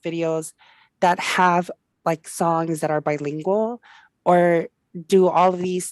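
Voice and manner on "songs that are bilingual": ordinary talk, nothing marked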